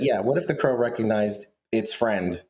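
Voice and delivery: ordinary talk, nothing marked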